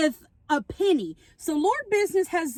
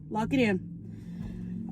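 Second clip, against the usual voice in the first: "Lock it in" drawn out, slow and soft; a slow, hushed "Lock it in"; slow hushed emphasis; 5 semitones lower, 11 dB quieter, much slower than usual. Shown as a demonstration. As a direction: let "Lock it in" sink in quietly, slowly.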